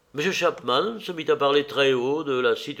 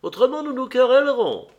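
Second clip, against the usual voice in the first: high, louder and quick; calling out; 9 semitones higher, 5 dB louder, faster than usual. A narrator's reading. Lady leaving the dinner table, calling out. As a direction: toss a parting warning from the doorway. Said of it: "cria Lady Marlborough de la porte".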